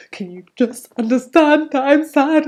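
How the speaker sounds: begging to be understood; pleading. Retold or imitated. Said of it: "for me, it's like".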